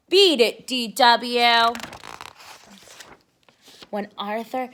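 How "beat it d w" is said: annoyed shout. Acted. Arthur shoos his sister away.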